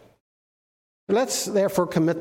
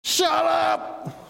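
commanding shout; 10 semitones higher than usual. Acted. Jesus orders the wind and waves, shouting. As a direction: shout the storm down. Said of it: "The actual translation is quiet or".

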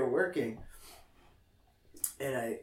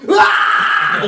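high, loud breathy yelp of laughter; 17 dB louder than usual. Real, not acted. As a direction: let out a shocked squeal. Laughing outburst after story.

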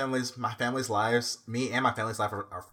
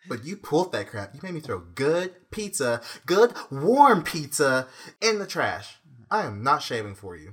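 emphatic, louder rant with slow stretched words; indignant retort; 4 semitones higher, 8 dB louder than usual. Retold or imitated. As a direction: lay down the grievance, leaning on each word. Retold indignant quarrel over pizza.

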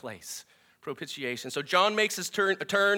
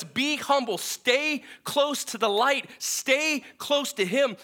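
higher, slow and emphatic plea; urgent call; 9 semitones higher, 3 dB louder, slower than usual. Acted. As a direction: call it out slowly, pleading with the congregation. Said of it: "He's reminding us".

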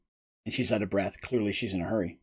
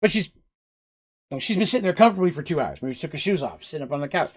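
usual speaking voice

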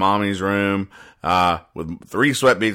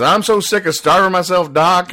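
whiny patient; 9 semitones higher, 4 dB louder than usual. Retold or imitated. Louise complaining to her doctor.

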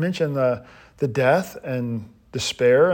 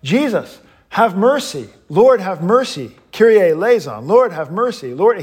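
raised, urgent plea for mercy; crying out; 4 semitones higher, 4 dB louder than usual. Acted. Blind beggar pleading with passing Jesus, crying out. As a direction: call out higher, over and over. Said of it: "he starts crying out".

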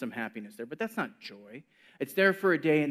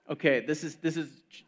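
normal talking voice

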